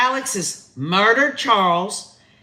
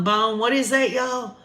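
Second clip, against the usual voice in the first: ordinary talk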